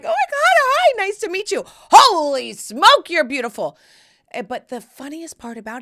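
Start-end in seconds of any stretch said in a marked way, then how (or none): 0.0-3.8 very high, loud, hammed-up squeal; retold starstruck gushing. Neighbor meeting the glamazon, squealing mock-starstruck. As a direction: gush helplessly, overwhelmed by beauty.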